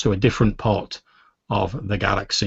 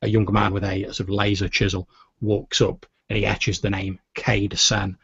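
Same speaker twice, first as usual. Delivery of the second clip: normal talking voice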